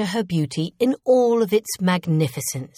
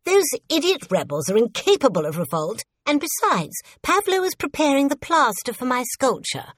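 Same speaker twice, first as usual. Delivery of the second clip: high, haughty royal voice; indignant queen giving orders; imperious queen; 5 semitones higher than usual. A narrator's reading. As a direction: dismiss the servant like an annoyed queen. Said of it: "retorted the queen".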